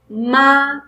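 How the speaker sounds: "ma" drawn out with the pitch rising; rising second tone. Shown as a demonstration. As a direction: lift the pitch through "ma". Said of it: "going to be rising tone".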